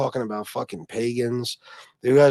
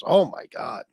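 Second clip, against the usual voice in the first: ordinary talk, nothing marked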